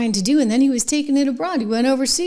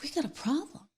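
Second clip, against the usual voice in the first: much softer, almost breathed; hushed lament; 5 semitones higher, 14 dB quieter than usual. Real, not acted. Host lamenting society, in a hushed grave voice.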